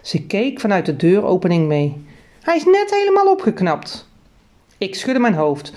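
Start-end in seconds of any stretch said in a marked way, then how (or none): 2.4-4.1 much higher, worried mother voice; worried mother about her garden, pitched high; fretting mother. Acted. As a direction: pitch it way up like a fussing mum.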